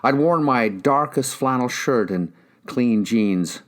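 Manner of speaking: ordinary delivery, nothing marked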